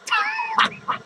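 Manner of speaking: high-pitched cackle; high, squealing burst of laughter; cackling laugh. Acted. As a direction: cackle high at your own joke. Laughs at own joke.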